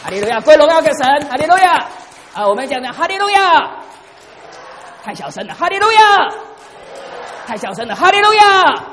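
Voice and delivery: loud, high call to the congregation; shouted hallelujah. Real, not acted. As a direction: rouse the crowd to shout back. (牧师大声带领会众喊哈利路亚)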